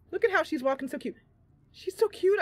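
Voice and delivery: high, delighted squeal over something cute; gushing. Real, not acted. Streamer watching her gnome sneak, high delighted gush. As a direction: coo at the little gnome.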